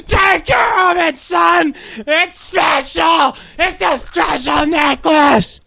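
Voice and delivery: high, strained full-volume yell; wounded father. A narrator's reading. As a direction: bellow slowly as a hammed-up dying father. Voices dying father in story.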